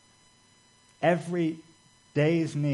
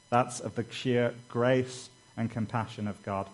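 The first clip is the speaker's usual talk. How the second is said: ordinary delivery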